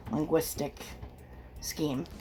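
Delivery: usual speaking voice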